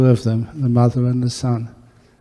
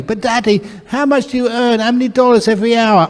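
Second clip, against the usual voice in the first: put-on much higher, pleading little boy; insistent child; 11 semitones higher, 4 dB louder, faster than usual. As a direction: beg and push, getting faster. Child pesters father.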